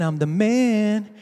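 I'm the man stretched slow and smug; slow drawn-out boast. Retold or imitated. Preacher's king savouring his boast, slow and smug. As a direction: savour each word. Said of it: "he's singing that".

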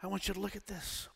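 ordinary talk, nothing marked

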